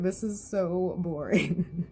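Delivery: slow, soft and bored. Retold or imitated. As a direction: drag it out, bored to death. Voicing bored Bomb.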